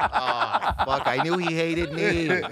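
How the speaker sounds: loud, high laughing paranoid rant; hammed-up panic about being hated; paranoid wail. A comic finding he's being recorded, loud laughing panic.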